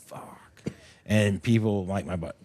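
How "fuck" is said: breathy whispered fuck; a defeated whispered curse. Retold or imitated. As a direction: whisper it in disgust at yourself. Self-critical whisper after stage.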